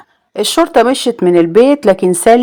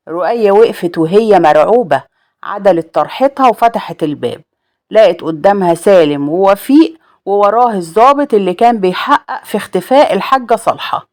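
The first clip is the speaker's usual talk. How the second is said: ordinary delivery, nothing marked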